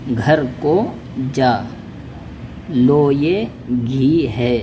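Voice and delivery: words stretched out slowly, syllable by syllable; slow reading drill. Shown as a demonstration. Teacher reading practice phrases to learners, slow. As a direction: read the phrases very slowly for beginners. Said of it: "इसी तरह आगे देखिए".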